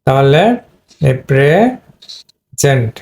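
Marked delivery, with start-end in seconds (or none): 1.3-2.9 প্রেজেন্ট split slowly into pri... ZENT; slow syllable-by-syllable pri-ZENT; verb pronunciation. Shown as a demonstration. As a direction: draw out প্রেজেন্ট, pausing before "zent". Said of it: "verb হলে প্রেজেন্ট".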